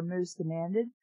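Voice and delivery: ordinary delivery, nothing marked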